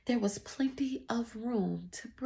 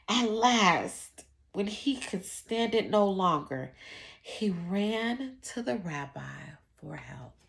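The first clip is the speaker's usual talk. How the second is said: ordinary delivery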